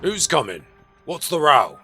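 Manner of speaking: urgent loud questions; alarmed demand. A narrator's reading. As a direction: call out to find out the trouble. Policeman alarmed by screaming man.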